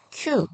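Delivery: 큐 short and high with a puff; aspirated 큐. Shown as a demonstration. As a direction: release the k of 큐 with air. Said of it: "이렇게 읽으시면 되시고요". (거센소리로 짧고 높게 큐)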